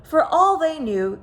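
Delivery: usual speaking voice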